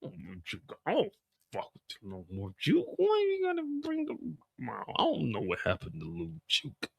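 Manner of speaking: put-on near-whispered drunk mumble while chewing; mumbled whisper. Imitating Kanan at the diner, whispering and chewing a burger. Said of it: "It's like he's whispering".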